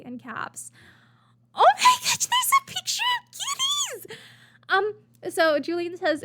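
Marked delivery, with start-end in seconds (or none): 1.5-4.2 very high, loud delighted squeal; real, piercing burst of delight; excited squeal. Real, not acted. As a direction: squeal with real glee at kittens. Delighted at seeing cat picture.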